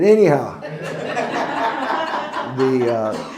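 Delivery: laughing. Real, not acted. Pastor laughs about his great-granddaughter texting. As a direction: laugh spilling into the words.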